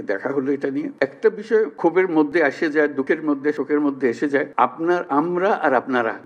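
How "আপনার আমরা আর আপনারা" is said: আমরা and আপনারা said slowly, each word weighed; slow, deliberate আমরা আর আপনারা; stressing the two words. Shown as a demonstration. Speaker to the families, slowly singling out আমরা and আপনারা. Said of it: "এই, এই দুটো শব্দ".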